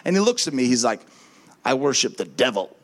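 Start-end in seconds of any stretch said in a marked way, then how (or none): 1.6-2.8 menacing. Retold or imitated. Tattooed young guy.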